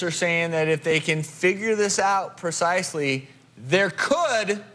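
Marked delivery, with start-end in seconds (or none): 3.6-4.6 "could" punched hard, set apart with a pause after; stress on COULD. Real, not acted. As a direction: lean heavily on the word "could". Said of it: "I emphasize the word could".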